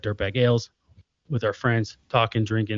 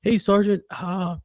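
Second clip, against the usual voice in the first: put-on higher, eager young soldier voice; young kid asking; 7 semitones higher than usual. Young soldier asking his sergeant, higher and eager. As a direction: play the green kid asking for advice. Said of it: "young kid that comes up to you and says".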